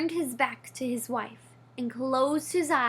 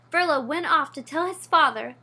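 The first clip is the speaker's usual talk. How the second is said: plain reading voice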